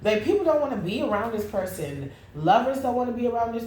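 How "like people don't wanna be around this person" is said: ordinary delivery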